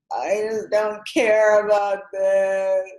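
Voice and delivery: hammed-up miserable whine, words stretched; mock despairing wail. Guest acting out her dark career moment, mock wailing.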